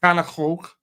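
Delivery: failed stab at Scouse; badly faked Scouse accent; attempted Scouse. Shown as a demonstration. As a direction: have a doubtful go at Scouse. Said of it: "No, I can't".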